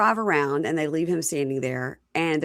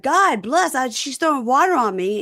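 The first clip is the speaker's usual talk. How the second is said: higher, louder mock outcry; retold indignant complaint; 6 semitones higher, 5 dB louder than usual. Demond complaining of thrown water, retold indignantly. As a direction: act out a wronged man's protest.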